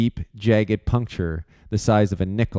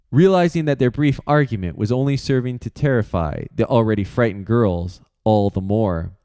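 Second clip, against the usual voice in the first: ordinary delivery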